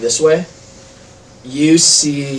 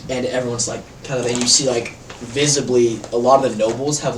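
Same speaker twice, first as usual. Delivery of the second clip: usual speaking voice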